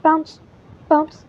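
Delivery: ordinary delivery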